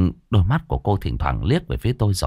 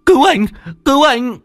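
desperate, high-pitched shout; acted panicked cry for help; screaming for help; 6 dB louder than usual. A narrator's reading. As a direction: yell for rescue, climbing sharply higher and louder. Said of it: "Anh Thành như bừng tỉnh hét lên".

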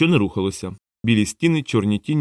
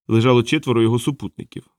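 usual speaking voice

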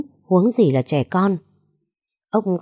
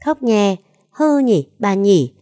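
put-on high, louder little boy tattling; teasing child; 4 semitones higher, 4 dB louder, slower than usual. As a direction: chirp it out like a cheeky toddler. Child teasing crybaby to grandma. (giọng trẻ con cao, trêu chọc)

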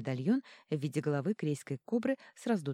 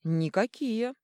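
louder, firm denial; 7 semitones higher, 5 dB louder, slower than usual. A narrator's reading. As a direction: state it flatly and firmly. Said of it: "твёрдо ответила Ники".

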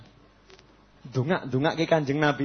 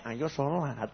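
inner thought; 3 semitones lower, 11 dB quieter, much slower than usual. Retold or imitated. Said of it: "Di hatinya".

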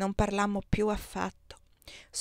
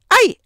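very high, loud yelp; sharp cry of pain; 14 semitones higher, 16 dB louder than usual. Acted. Wife crying out when her husband bites her finger.

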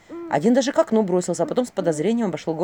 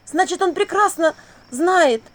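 high, loud and slowing as it sinks in; astonished realisation; 7 semitones higher, 4 dB louder, slower than usual. A narrator's reading. Vasilisa working out who the beast is, shocked realisation. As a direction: piece it together aloud in drawn-out amazement.

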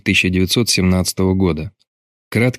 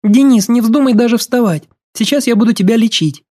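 high, loud female voice, firmly protesting; resolute objection; 4 dB louder than usual. A narrator's reading. Юлька stops sick Денис getting out of bed, resolute. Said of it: "она решительно воспротивилась".

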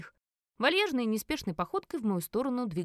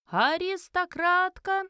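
high, loud, very drawn-out announcement; ringing auction call, vowels stretched; exclaiming elf auctioneer; 9 semitones higher, 5 dB louder, much slower than usual. A narrator's reading. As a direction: proclaim the main lot like a showman. Auctioneer starts presenting the lot.

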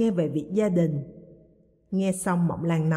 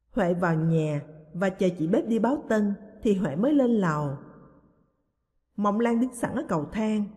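ordinary delivery

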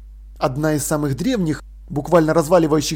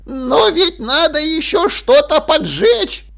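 very high, loud, slow objection; insistent protest; 10 semitones higher, 5 dB louder, slower than usual. A narrator's reading. Dil refuses to give in, insisting loudly. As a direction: keep arguing, pitched right up. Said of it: "не сдавался Диль".

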